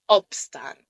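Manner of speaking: "Opstaan" with short open first O, long A. Shown as a demonstration. Teacher demonstrating for learners, short open O. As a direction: say "Opstaan" opening the mouth tall on the brief O. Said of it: "tu étends un peu plus ta bouche".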